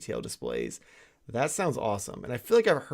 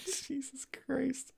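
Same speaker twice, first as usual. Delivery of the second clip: whispering; 13 semitones higher, 10 dB quieter, much slower than usual. Real, not acted. Laughing disbelief at the joke.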